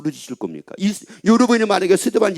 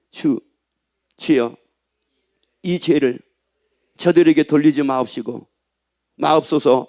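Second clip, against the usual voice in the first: lower, solemn reading of the prayer; scripture reading; 4 semitones lower than usual. Acted. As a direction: read the forgiving prayer gently. Reads Stephen's prayer. (용서의 기도 낭독)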